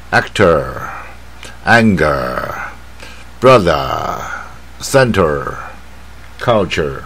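"actor, anger, brother, center, culture" with each final syllable held and curled; very slow list, endings drawn out. Shown as a demonstration. Teacher showing students the word endings, very slow and drawn out. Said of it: "比较放在后面啊".

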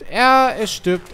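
high, loud panic as it dies; panicked yelp. Real, not acted. Streamer watching his dino die, panicked yelp. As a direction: cry out in alarm.

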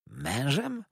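high, rising astonished question. A narrator's reading. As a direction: echo the word in disbelief, pitch climbing. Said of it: "zawołałem zdumiony".

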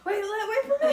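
high, loud, laughing plea; hurrying not to miss it; eager. Retold or imitated. Mom calling out not to start without her, high laughing plea. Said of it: "And Mom's like".

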